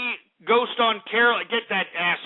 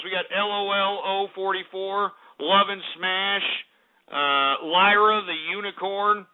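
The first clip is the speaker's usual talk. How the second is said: ordinary delivery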